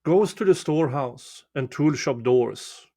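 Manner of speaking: usual speaking voice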